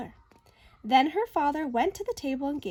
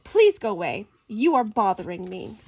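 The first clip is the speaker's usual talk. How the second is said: put-on grumpy boy; 5 semitones lower, 7 dB louder than usual. Boy annoyed in bath.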